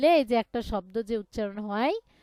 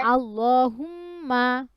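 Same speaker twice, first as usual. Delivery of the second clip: recitation for repeating; 4 dB louder than usual. Shown as a demonstration. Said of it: "বলেন".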